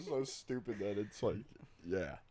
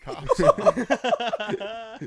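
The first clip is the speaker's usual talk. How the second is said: laughing through the words; 8 semitones higher, 11 dB louder, slower than usual. Real, not acted. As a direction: say it while cracking up.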